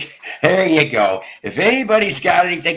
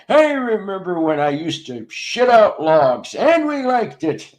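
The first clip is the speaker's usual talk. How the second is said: put-on grumpy old-timer; 7 semitones higher, 3 dB louder than usual. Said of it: "these kids nowadays".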